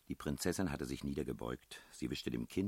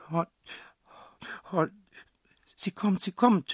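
put-on high, loud panicked child; child's frightened cry; 11 dB louder than usual. A narrator's reading. Child sees the woman coming, shrieks in fear. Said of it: "schrie das Kind".

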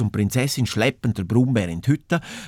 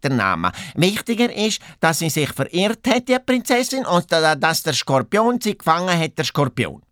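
higher-pitched, talkative hare character; chatty hare; 5 semitones higher than usual. Acted. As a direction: explain it as an eager little hare. (hohe, geschwätzige Hasenstimme)

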